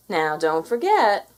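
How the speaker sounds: slow, firm reminder; warning. A narrator's reading. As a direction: warn her gently but firmly.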